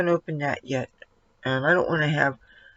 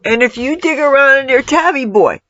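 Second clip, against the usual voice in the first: louder, higher, fast warning to the cat; scolding the cat; 7 semitones higher, 6 dB louder than usual. Real, not acted. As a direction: warn the cat off, raised voice. Scolding cat digging around.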